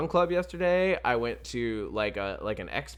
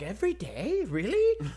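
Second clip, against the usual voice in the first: pitched-up, incredulous every day really; mock amazement; 7 semitones higher than usual. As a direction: play stunned disbelief, climbing high. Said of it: "They're like".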